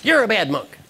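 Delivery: high mock-angry outburst; acted indignant scolding; angry monk. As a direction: accuse him, still fuming. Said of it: "his colleague is still angry".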